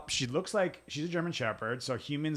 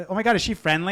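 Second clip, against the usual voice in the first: mock stranger voice; 7 semitones higher, 7 dB louder, faster than usual. Mocking stranger asking about dog.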